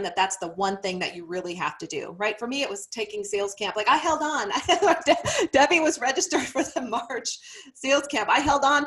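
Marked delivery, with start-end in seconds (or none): 3.7-8.8 laughing it out, pitched up and loud. Real, not acted. Coach laughs at how she clung to the live camp. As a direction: break into a real laugh while admitting it.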